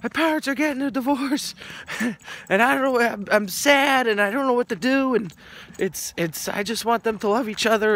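put-on retelling his boyhood self confessing to his teacher through tears, high and teary; imitating his crying younger self. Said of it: "I was like".

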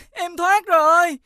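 high, drawn-out cry of relief; joyful cry out. Acted. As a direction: cry out high and slow, freshly freed. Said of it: "em đừng la to". (reo lên mừng rỡ, giọng cao)

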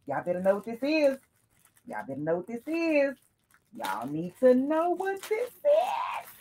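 high sing-song teasing; playful taunt. Real, not acted. Repeated playful catchphrase.

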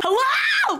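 high-pitched, stunned "hello"; incredulous cry. Real, not acted. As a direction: squeal it in disbelief. Disbelieving reaction to the scene.

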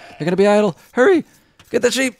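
urgent, high and loud, rising to a yell; excited shouting. Real, not acted. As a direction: yell at the player to hurry. Caster urging a player on.

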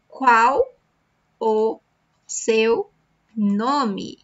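"Qual O seu nome" split with pauses between each word. Shown as a demonstration. Teacher breaking the question into words for practice. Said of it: "Practice here".

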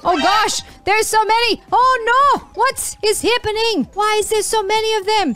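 overwhelmed and squealing in alarm; real panic. Real, not acted. Swarmed by enemies, panicking.

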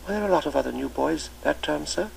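put-on new boy shyly asking the old master; shy schoolboy. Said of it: "asked Linford shyly".

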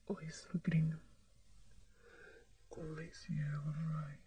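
hushed whispering. Acted. Hospital scene, whispering. (sussurro baixinho)

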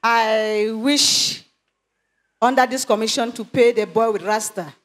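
ordinary delivery

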